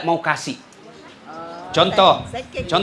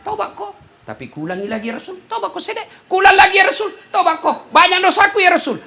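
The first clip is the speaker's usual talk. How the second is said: put-on young sinner confessing; 8 semitones higher than usual. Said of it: "Datanglah anak muda kepada Nabi".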